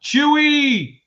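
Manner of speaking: high, loud drawn-out name call; big stretched-out greeting yell. Real, not acted. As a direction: holler a shout-out to a buddy. Hailing someone in a shout-out.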